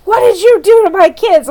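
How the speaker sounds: high, loud angry mother; imitating a furious mother on the phone; furious demand. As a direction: demand it in outrage. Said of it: "she's furious".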